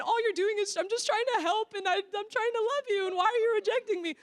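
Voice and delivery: high, whiny tearful plea; mock crying. Rejected helper pleading with the girl she tried to love, mock tearful. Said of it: "you're gonna probably cry".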